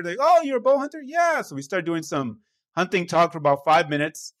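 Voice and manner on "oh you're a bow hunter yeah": high-pitched, delighted surprise; excited reaction. Retold or imitated. Hunters greet a fellow bow hunter, excited. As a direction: imitate guys thrilled to find common ground.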